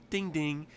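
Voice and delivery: high sing-out "ding ding"; imitated bell ding. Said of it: "and you hear, like".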